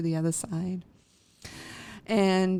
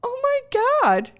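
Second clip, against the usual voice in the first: shrieked much higher and louder; shocked oh my God; 6 dB louder than usual. Retold or imitated. Caught off guard by the pet psychic, shrieking. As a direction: squeal it out in disbelief. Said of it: "And I'm like".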